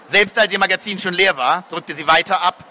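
ordinary delivery, nothing marked

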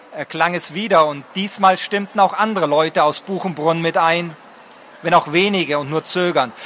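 ordinary delivery

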